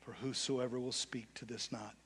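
quiet, intimate preaching; soft altar call. Acted. Pastor urging the congregation to speak to their knots, soft appeal. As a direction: draw them in softly, low and close.

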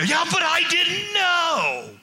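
mock the excuse full force; hammed-up whining shout; exasperated shout. Excuse repeated louder.

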